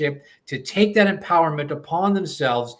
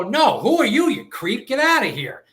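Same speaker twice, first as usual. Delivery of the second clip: higher, louder, swooping mock outrage; mock indignant rejection; 7 semitones higher, 3 dB louder than usual. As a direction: act out sending a creep packing. Acted scornful rejection in a quote.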